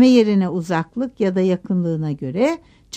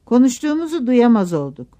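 ordinary delivery

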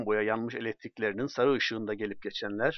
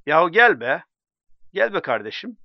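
higher and louder pleading call; coaxing call; 6 semitones higher, 10 dB louder than usual. Acted. Drunk man calling friend into the tavern, loudly coaxing. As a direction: call him in from the tavern door.

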